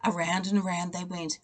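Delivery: usual speaking voice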